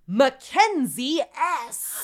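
slow, high, loud name call; big slow name reveal; drawn-out announcement. Pretend. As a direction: announce the name like a game show host.

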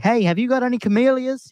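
high-pitched, badly faked British accent; terrible British accent. British customer asking a new worker for camellias, in a terrible accent. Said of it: "He was British. That's a terrible accent".

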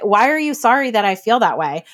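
ordinary talk, nothing marked

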